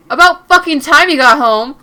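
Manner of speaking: loud furious shout at her; angry yell. Acted. Angry boyfriend yelling at Mary for coming home late. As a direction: yell it at her the moment she walks in.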